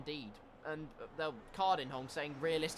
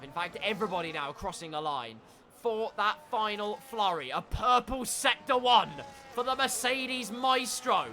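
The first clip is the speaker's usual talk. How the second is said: excited race call, raised voice; live play-by-play; 5 semitones higher, 5 dB louder than usual. Real, not acted. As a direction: drive through the final flurry with energy.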